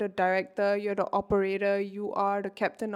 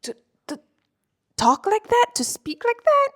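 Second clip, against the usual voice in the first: snooty ego voice; 6 dB louder than usual. Acted. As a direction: play the outraged inner critic, pitched way up. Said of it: "your ego is gonna come in and be like".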